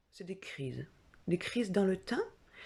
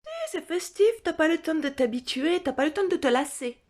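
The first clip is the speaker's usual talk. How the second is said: much higher and louder, bubbly sarcasm; faster, exclaimed ironic cheer; mock enthusiasm; 9 semitones higher, 6 dB louder than usual. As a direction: sell it like a party, sarcastically. Mock enthusiasm about festive symptoms.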